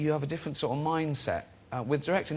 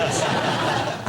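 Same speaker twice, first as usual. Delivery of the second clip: real airy laugh, louder; breathy laugh; 5 dB louder than usual. Real, not acted. Laughing at his email to the actor. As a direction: laugh out at your own line.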